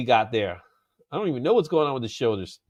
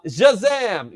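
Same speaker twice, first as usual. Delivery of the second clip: drawn-out, punchy "Shazam!"; exclaimed Shazam; 7 semitones higher, 5 dB louder than usual. Shown as a demonstration. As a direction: stretch out "Shazam!" like a magic word. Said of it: "You always gotta say it with the".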